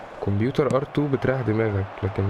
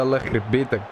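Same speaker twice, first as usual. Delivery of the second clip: usual speaking voice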